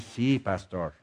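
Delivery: timid soft "Sí, pastor", both words low and weak; hesitant, barely voiced "Sí, pastor"; meek quiet reply. Shown as a demonstration. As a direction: mumble "Sí, pastor" like a shy churchgoer. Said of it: "tú dices".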